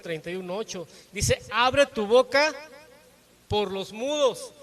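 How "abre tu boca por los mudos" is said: proclaimed verse. Acted.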